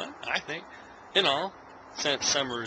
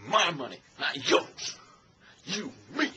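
pointing, preachy Denzel rant, lightly voiced; Denzel Washington impression. Mocking Denzel in John Q scolding about a guitar, lightly voiced. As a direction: jab each word like pointing at things. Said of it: "That's basically Denzel Washington".

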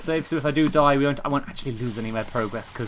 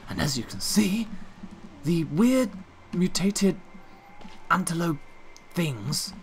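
hushed nature documentary narration; a lightly done wildlife narrator; David Attenborough impression. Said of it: "Being David Attenborough".

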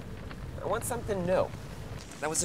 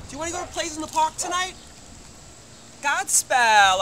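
ordinary talk, nothing marked

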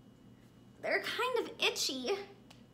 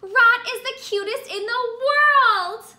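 loud, high winner's announcement; grand announcement; 8 semitones higher, 10 dB louder than usual. Acted. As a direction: proclaim it like a show host.

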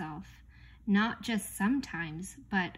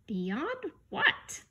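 puzzled questioning; 5 semitones higher than usual. A narrator's reading. Milo questions what he reads.

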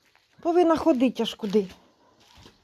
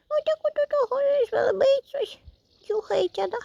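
pet talk; 12 semitones higher than usual. Real, not acted. Speaking to the cat in a high baby voice. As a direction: talk like a tiny kitten.

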